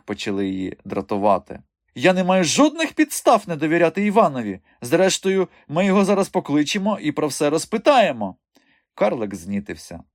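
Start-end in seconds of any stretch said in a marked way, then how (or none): 1.9-8.4 high, firm lady's voice; irritated circus madam. A narrator's reading. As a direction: rebuff him in a high, loud voice. Irritated madam rebuffs the dwarf. (роздратований високий голос пані)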